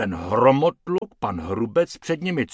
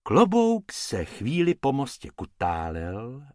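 usual speaking voice

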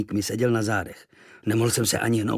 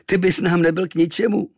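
mocking sailors; 7 semitones higher, 4 dB louder than usual. A narrator's reading. Sailors burst out at the boy's wish, mocking. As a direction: scoff at the little squirt. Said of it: "námořníci se rozchechtali".

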